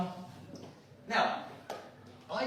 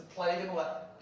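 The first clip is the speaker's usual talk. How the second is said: ordinary delivery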